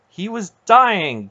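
high, loud, drawn-out protest. A narrator's reading. Garion defending himself to his aunt, loud protest. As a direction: plead your case forcefully. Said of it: "Garion protested".

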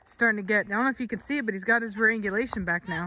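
ordinary talk, nothing marked

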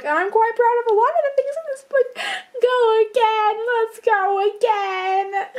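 high, breaking into laughter; laughing through it. Real, not acted. Author giving up on the take, laughing.

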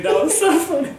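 high-pitched laughing; can't stop laughing. Real, not acted. Still laughing at friend.

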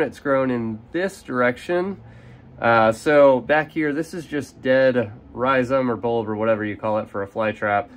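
normal talking voice

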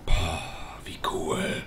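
hushed breathy wow; awed whisper. Real, not acted. Player amazed by the view, hushed whisper. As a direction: whisper in awe.

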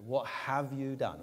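"have" leaned on, slow and accusing; stress on have. Shown as a demonstration. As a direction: hit the word "have" in "What have you done?". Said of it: "put the emphasis wherever you like".

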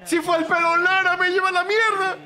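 loud, high frustrated yell; exasperated shout. Real, not acted. Furious at a botched play. (grito de exasperación)

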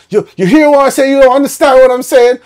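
high, loud imitation of a Barbadian man; Bayesian accent impression. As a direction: press him with a loud Bajan-flavoured question. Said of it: "he's like".

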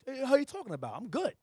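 lightly voiced touchy self brushing it off; emotional self. The emotional self answering the elder, mildly defensive. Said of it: "the emotion me will say".

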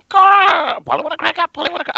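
high squawky parrot call. Acted. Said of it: "imitating the bird calls".

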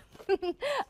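high teary laugh; relieved laugh. Real, not acted. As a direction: laugh it off after tears. Relieved laugh after tears.

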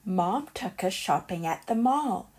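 ordinary delivery

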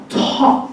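「タ」のTに息をどっと乗せる; 高めに、息を爆発させて「タ」; 強い気息のT. Shown as a demonstration. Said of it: "息がボワーンと出てきます". (息たっぷりの英語式「タ」)

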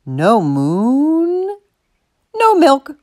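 long drawn-out MOON, then short high NO MILK; insistent, lingering ultimatum; firm confirmation. A narrator's reading. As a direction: confirm it stubbornly, stretching MOON. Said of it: "Martha confirmed".

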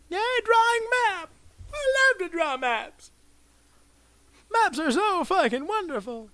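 high sing-song fake enthusiasm; mock cheer. Real, not acted. Sarcastically cheering about the daily map challenge.

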